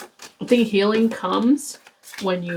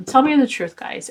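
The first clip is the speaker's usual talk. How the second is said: ordinary talk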